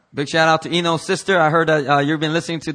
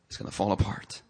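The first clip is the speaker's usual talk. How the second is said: ordinary talk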